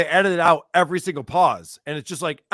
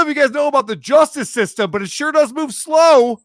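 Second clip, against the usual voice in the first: exasperated; 9 semitones higher, 6 dB louder than usual. Real, not acted. Complaining about slow justice.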